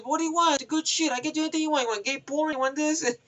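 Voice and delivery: high, pushy street-seller voice; hustling kid. Retold or imitated. Iraqi kid pitching DVDs to Renner, high pushy voice. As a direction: sell it fast and high like a cheeky kid.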